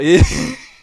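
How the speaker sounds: short high burst of laughter; loud laugh. Real, not acted. Reacting to the woman walking off with the ball, loud laugh.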